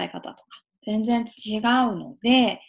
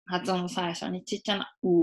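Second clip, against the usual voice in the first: normal talking voice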